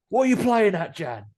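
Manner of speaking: high, loud indignant scolding; a ghost telling Jan off like a cross dad. Retold or imitated. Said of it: "something I never thought I'd hear a ghost say".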